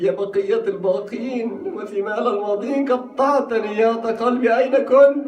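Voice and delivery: a worried aunt crying out for him; anxious aunt calling out. Acted. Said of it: "So she asked him".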